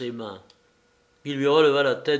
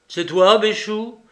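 loud, higher call; 8 semitones higher, 8 dB louder than usual. A narrator's reading. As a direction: shout the name through the door.

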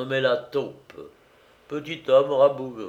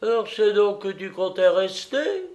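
bitter; 10 semitones higher, 3 dB louder than usual. Acted. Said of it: "dit Hakim avec amertume".

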